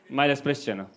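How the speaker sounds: usual speaking voice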